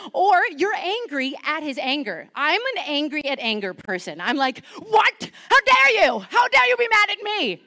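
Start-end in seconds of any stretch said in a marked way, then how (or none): 4.8-7.6 high, loud "How dare you" outburst; playful over-the-top shout at God; mock outraged shout. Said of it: "That's loud".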